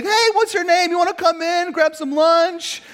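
high, loud, eager invitation, slowing at the end with a laugh; beaming high welcome to strangers; friendly neighbor greeting. Retold or imitated. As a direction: call out to passers-by like a cheery host. He's like.

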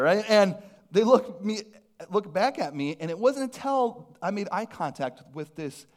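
ordinary talk, nothing marked

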